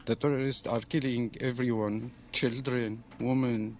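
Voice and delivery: usual speaking voice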